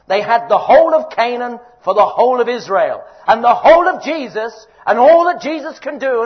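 loud, hammering each all; fervent preaching. Real, not acted. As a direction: lift the congregation with conviction. Preaching at full force.

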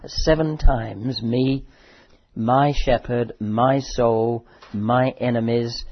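loud, emphatic sermon voice; preaching. Acted. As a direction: drive home how personal it is. Lists the psalm's "me"s.

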